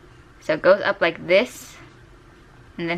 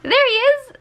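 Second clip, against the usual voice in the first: excited. Real, not acted. Artist finding her lost drawing, delighted shriek. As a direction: shriek with joy, much higher. Finds drawing on hidden layer.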